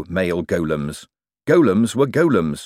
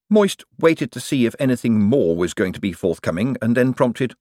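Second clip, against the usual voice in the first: plain reading voice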